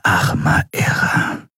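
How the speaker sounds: slow, voiceless whisper; stunned whisper. A narrator's reading. Shiar over the dead prisoner, aghast whisper. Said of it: "flüsterte Shiar entgeistert".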